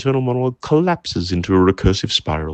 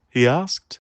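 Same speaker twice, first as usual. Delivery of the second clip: plain reading voice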